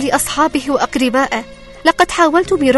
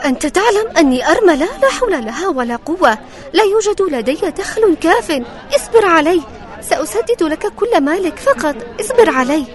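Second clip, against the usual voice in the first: higher, pleading poor widow; 4 dB louder than usual. Acted. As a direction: ask humbly like a poor widow. Widow begs for patience.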